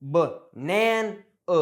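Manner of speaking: "b-nan-a" broken up, punching "nan"; "b-nan-a", stressed middle between two schwas; syllable stress demo. Shown as a demonstration. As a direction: say "b-nan-a" syllable by syllable, weak "buh" and "uh". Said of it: "always gonna stress one syllable".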